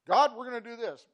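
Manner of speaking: mock bold declaration. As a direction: declare it like a stubborn kid. Mocking his own presumptuous prayer.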